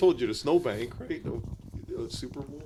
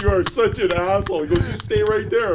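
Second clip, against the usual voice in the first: higher, louder exasperated scold; scolding buddy; 8 semitones higher, 6 dB louder than usual. Retold or imitated. Police buddy on phone scolding him, exasperated. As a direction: scold a troublemaking friend.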